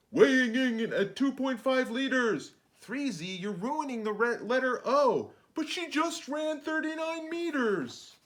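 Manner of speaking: lively mock announcer; excited announcer. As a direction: hype it like a boxing ring announcer. Announcer-style intro.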